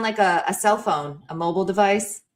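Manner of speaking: ordinary delivery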